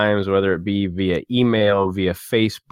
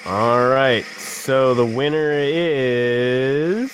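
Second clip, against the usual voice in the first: slow, drawn-out suspense reveal; hyped giveaway announcement; 4 semitones higher, much slower than usual. Acted. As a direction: stretch it out like a game show host. Drum-roll before naming giveaway winner.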